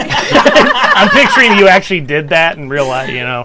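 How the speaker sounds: talking through laughter; laughing. Real, not acted. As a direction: speak while cracking up.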